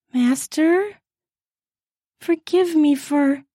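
put-on high, hesitant young woman's voice; faltering Rose. A narrator's reading. Rose apologizing to her master, high and hesitant. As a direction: start an apology and lose your nerve. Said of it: "Rose faltered".

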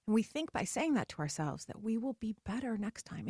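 usual speaking voice

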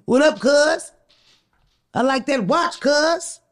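lightly put-on L A street voice, casual menace; mock L A street accent. Imitating an L A stranger eyeing her watch, mock L A accent. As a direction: play an L A guy sizing up a mark in his L A accent. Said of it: "That's my accent. That's my inter-, like, interpretation of, like, a L A person trying to accost you".

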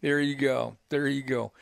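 normal talking voice

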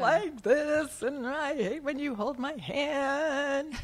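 put-on high, slow whiny kid voice; a child grumbling to mom. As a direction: complain like a small child. Voicing a complaining child.

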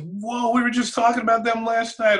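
high, thrilled whoa of surprise; light up with delighted surprise; excited surprise. Retold or imitated. Telling his traded friend, an excited whoa of surprise. Thrilled teammate hears Baltimore news.

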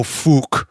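"fook" with a long oo like "book"; Dublin fook. Shown as a demonstration. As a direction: Dublin way of saying fuck. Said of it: "they go".

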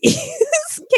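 a sudden high giggle; laughing at herself; high burst of laughter. Real, not acted. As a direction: squeal of embarrassed laughter. Laughing at own mispronunciation.

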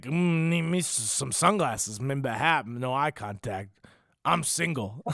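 slow, slurred drunk man imitation; drunk regular. Drunk regular clumsily hitting on a woman, slow drunken slur. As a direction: drag the words out like a drunk at the bar. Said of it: "I'm doing my best impression of".

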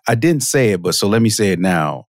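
ordinary delivery

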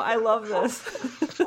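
laughing through a big smile; smiling voice. Real, not acted. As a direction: say it beaming and giggling. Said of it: "I am smiling so big right now".

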